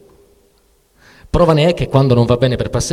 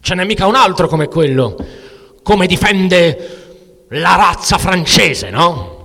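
higher and louder, the middle stretched slow; Artur provoking; 8 semitones higher, 6 dB louder than usual. Acted. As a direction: goad him with mock pride. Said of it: "che mi provoca Artur". (provocazione più acuta e forte, con parole strascicate)

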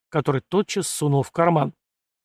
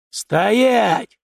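sharp loud bark, higher; barked order; 6 semitones higher, 5 dB louder than usual. A narrator's reading. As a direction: bark the command like a sudden soldier. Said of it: "рявкнул до того спокойный помощник".

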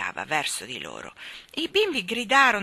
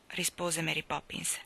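plain reading voice

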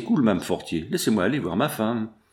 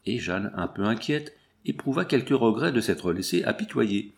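usual speaking voice

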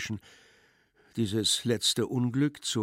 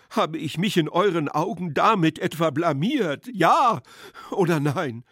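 higher, louder pleading, peaking in a demand; distraught appeal; 6 semitones higher, 5 dB louder than usual. A narrator's reading. His Excellency asks guests if he disgraced himself, distraught. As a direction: plead with rising urgency for an answer.

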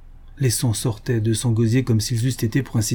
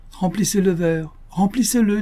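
higher, climbing into a barked order; angry demand; 8 semitones higher than usual. Acted. Dying baronet ordering the doctor to fill his glass, angry. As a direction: demand the glass be filled, rising.

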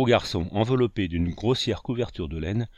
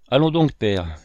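louder, plaintive call; raised voice, a touch whiny; tearful cry; 3 semitones higher, 7 dB louder than usual. A narrator's reading. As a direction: cry out for help in mild distress. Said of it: "cria-t-elle d'une voix larmoyante".

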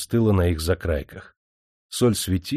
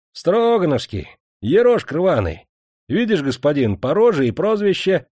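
higher, louder, jeering peasant voice; mocking reply; 8 semitones higher, 5 dB louder than usual. A narrator's reading. Salt worker answers the master, jeering. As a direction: throw the answer back with open mockery. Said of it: "насмешливо ответил мужик".

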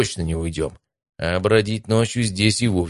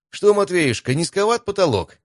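high-pitched playful jibe; teasing; 12 semitones higher than usual. A narrator's reading. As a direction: needle a friend in a much higher voice. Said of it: "подколол парня Стас".

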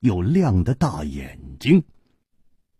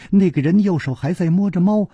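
usual speaking voice

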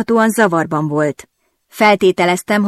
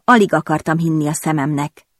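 ordinary delivery

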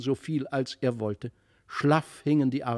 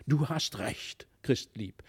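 ordinary delivery, nothing marked

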